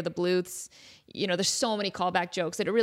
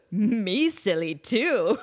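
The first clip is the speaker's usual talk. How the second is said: slow, dopey movie-character voice; goofy Ed voice; 2 semitones lower, much slower than usual. Retold or imitated. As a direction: drag out the words like a dimwit.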